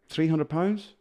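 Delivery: high, questioning rise; unsure guess. Retold or imitated. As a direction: guess it hesitantly, voice climbing high. Retold unsure guess at own weight.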